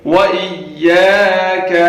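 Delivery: وَإِيَّاكَ with the yaa stretched; long ya. Shown as a demonstration. Teacher showing the lengthened ya. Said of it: "یا کو کھینچ کے پڑھیں گے".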